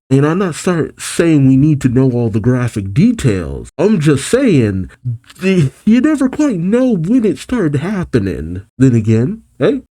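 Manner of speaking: ordinary delivery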